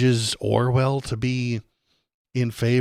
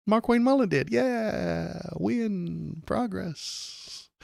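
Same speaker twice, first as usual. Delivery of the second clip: much higher, slow mock cheer of naive Democrats; 9 semitones higher, much slower than usual. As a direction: gush like clueless fans of a hollow win. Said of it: "the Dems can be like".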